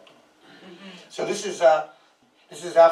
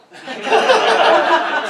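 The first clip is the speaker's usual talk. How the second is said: loud high laugh; 14 semitones higher, 8 dB louder than usual. Real, not acted. Laughs at audience's question.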